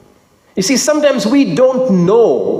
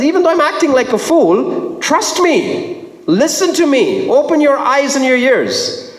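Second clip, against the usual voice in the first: high, emphatic urging as Paul; insistent plea; 8 semitones higher than usual. Acted. Paul asking doubters to trust him, insistent plea. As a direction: urge them in a raised pitch.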